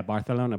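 Barcelona with a lisped c, bar-the-lona; Spain Spanish lisp. Shown as a demonstration. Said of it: "they only lisp C's".

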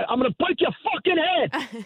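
riled-up New York accent, pitched high; angry New York. Shown as a demonstration. Host demonstrating how his accent comes out when upset, angry New York accent. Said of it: "that sounds more New York".

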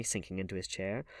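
plain reading voice

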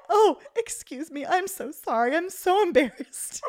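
high-pitched, mortified apology, imitated with amusement; embarrassed apologetic lady. Retold waiting-room lady owning up to the fart, embarrassed apology. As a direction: put on a flustered lady apologizing profusely. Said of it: "and the lady goes".

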